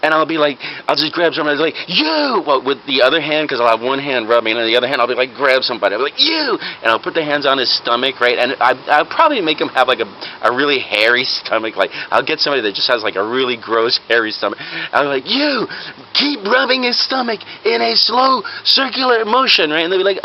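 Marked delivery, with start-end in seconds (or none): 1.9-2.4 sharp high-pitched summons; single someone out with mock authority; commanding callout. Singles out a bystander. 6.2-6.6 sharp high-pitched summons; single someone out with mock authority; commanding callout. Singles out a bystander. 15.3-19.7 high, slow, emphatic instruction; commanding fake doctor. Fake doctor orders a bystander.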